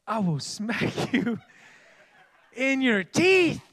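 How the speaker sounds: slow, rising to loud playful threat; hammed-up smack threat; mock threat. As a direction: threaten with a grin.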